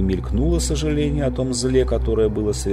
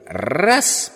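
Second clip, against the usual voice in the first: higher, eager shout; quick shout; 7 semitones higher than usual. Acted. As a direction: call it out quickly. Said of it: "подхватила Полли".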